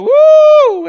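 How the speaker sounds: high, loud, drawn-out whoop; joyful woo. Retold or imitated. Believer feeling the Holy Ghost, whooping with joy. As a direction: let out a happy whoop. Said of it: "feeling the Holy Ghost".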